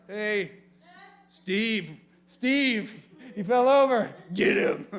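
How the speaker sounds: loud mock call to Steve; piggy brother calling. Pig calling brother Steve to the fallen piglet, loud and hammed up. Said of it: "their brother".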